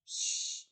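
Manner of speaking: breathy warning shush; hushing shh. Retold or imitated. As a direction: hush your partner sharply.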